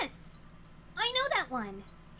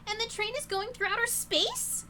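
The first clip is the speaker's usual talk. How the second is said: louder, higher incredulous question; alarmed disbelief; 8 semitones higher, 8 dB louder than usual. Acted. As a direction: blurt it out in astonishment. Game character alarmed at outer space.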